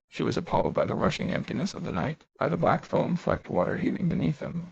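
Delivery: ordinary delivery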